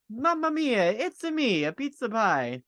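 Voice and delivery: put-on hammy Italian pizza chef accent. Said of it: "If Mario had to speak in a specific accent".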